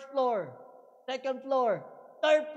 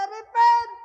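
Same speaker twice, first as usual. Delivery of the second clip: prophet shouting Repent; loud cry of Repent; 4 semitones higher, 9 dB louder than usual. Acted. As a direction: call out Repent to the crowd. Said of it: "sabi niya".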